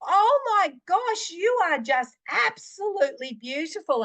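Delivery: retold shout. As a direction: belt it out across the shopping centre like she did. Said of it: "On the top of her voice".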